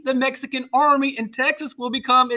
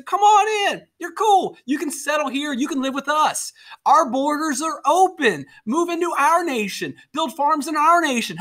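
raised pitch, acted-out open welcome; welcoming invitation; 5 semitones higher than usual. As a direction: play the host inviting newcomers in. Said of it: "they send us a personal invitation saying".

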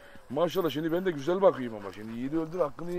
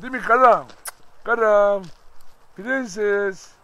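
seslenme; 3 semitones higher, 7 dB louder, much slower than usual. Real, not acted. Köpeğe sevgiyle sesleniyor.

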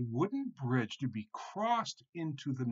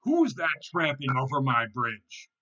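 big loud troll demand; troll's loudest roar; 3 semitones higher, 9 dB louder than usual. A narrator's reading. Troll demands who tramps over his bridge, loud roar. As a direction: bellow the question. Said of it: "he roared louder than ever".

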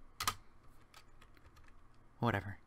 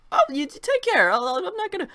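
mock high voice; 9 dB louder than usual.

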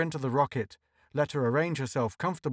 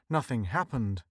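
usual speaking voice

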